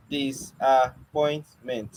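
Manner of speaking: Disappointment drawn out slowly, dis-ap-point-ment, syllables pulled apart. Shown as a demonstration. Teacher slowly breaking Disappointment into syllables for the students. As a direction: stretch Disappointment so each stop is heard. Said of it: "How is it five?".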